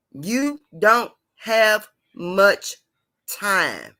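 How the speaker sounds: very slow, word by word; deliberate, drawn-out warning. Real, not acted. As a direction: space out every word for weight. Hammering home a repeated warning.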